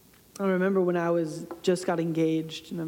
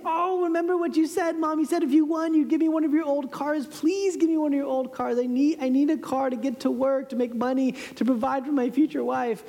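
begging; 7 semitones higher than usual. Retold or imitated. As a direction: plead eagerly with mom. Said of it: "my begging continues again".

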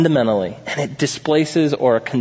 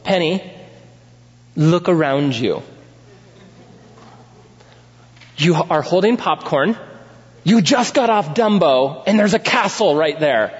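dad to daughter; 4 semitones higher than usual. Retold or imitated. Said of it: "get down on her level and say".

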